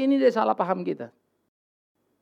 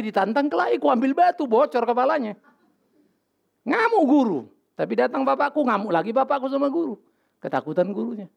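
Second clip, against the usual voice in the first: ordinary talk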